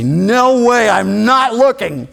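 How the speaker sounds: high, loud, stubborn no; defiant refusal. Acted. A bitten man refusing to look, loud defiance. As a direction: shout down the offer.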